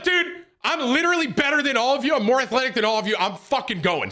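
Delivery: fast, high-pitched indignant rant; pissed-off boasting. Retold or imitated. Telling off the friends who teased him, pissed-off boast. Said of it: "pissed at everybody".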